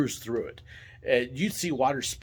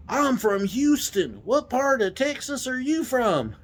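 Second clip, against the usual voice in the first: high, slow, broad Texas drawl; Texan challenge; 10 semitones higher, slower than usual. Retold or imitated. Houston guest confronting the driver, broad Texas drawl. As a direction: imitate a Texan with exaggerated twang.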